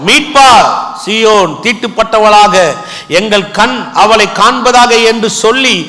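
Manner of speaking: slow, drawn-out proclamation; emphatic verse reading in separated phrases; scripture declamation. Acted. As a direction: read the prophecy out with building force.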